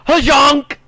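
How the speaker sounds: high, loud goofy honk; loud yelp. Acted. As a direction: blurt it out like a honk. Shouted catchphrase.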